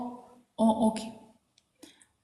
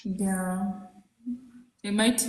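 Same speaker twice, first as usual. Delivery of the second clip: ordinary delivery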